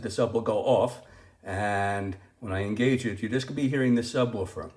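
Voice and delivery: ordinary talk, nothing marked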